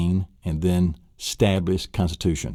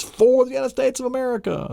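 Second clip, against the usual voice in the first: high, proud declaration; proud cry. Retold or imitated. Michael Jordan dedicating his shots to the country, high proud cry. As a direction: announce it like a national hero. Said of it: "This is for the United States of America".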